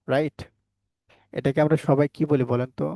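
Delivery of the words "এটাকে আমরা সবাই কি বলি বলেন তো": ordinary talk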